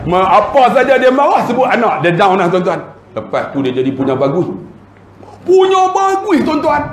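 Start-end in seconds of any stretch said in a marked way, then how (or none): 5.4-6.8 squeaky-high repeat for comic effect; exaggerated emphasis. Real, not acted. Preacher marvelling to congregation, exaggerated high-pitched emphasis. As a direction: pitch PUNYA BAGUS way up. Exaggerated praise of the changed boss.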